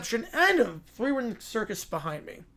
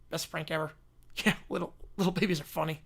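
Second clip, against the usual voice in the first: usual speaking voice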